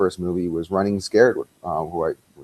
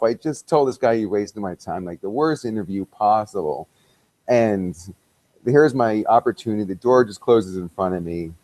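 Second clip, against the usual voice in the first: ordinary talk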